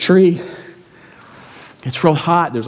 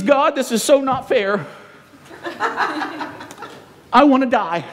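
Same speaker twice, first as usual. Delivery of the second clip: put-on whining teen; 8 semitones higher, 3 dB quieter than usual. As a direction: drag it out like a pouting teenager. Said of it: "He says".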